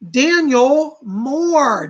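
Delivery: slow, loud drawn-out reveal; winner announcement. Acted. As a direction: stretch the name out like a game show host.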